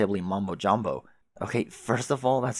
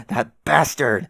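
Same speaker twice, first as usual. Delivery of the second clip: angry outburst; 2 semitones higher, 7 dB louder, slower than usual. Acted. John enraged by the prank.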